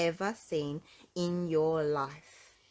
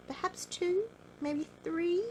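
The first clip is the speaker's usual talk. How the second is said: high guessing; 12 semitones higher than usual. A narrator's reading.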